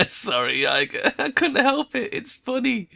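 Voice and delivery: high, slow, giggling; laughing apology. Real, not acted. Player apologizing to Iger while still giggling.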